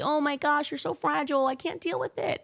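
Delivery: high, whiny mock of a fragile celebrity. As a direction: sneer it like a pampered crybaby. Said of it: "Now, y".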